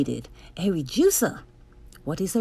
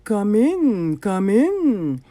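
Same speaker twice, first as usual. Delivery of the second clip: louder, silky villain invitation; purring; 6 dB louder, much slower than usual. Acted. Doctor Brock lets the hero in, purring. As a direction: lure him in smoothly. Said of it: "he purred".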